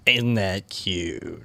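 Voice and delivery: low, slow, soft put-on voice; mock deep voice.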